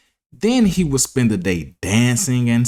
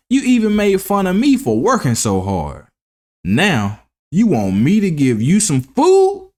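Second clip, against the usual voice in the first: lightly voiced reproachful ant, higher; scolding ant; 5 semitones higher than usual. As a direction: lecture him, then refuse. Ant refusing the grasshopper.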